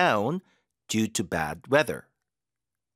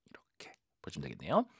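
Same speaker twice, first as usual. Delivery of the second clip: soft whisper; 8 semitones lower, 9 dB quieter, faster than usual. Real, not acted. Soft aside to viewers.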